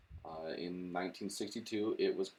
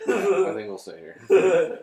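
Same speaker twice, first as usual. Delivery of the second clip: loud, high, slow laugh-through speech; laughing agreement; 10 semitones higher, 14 dB louder, slower than usual. Real, not acted. Both laughing about staying put.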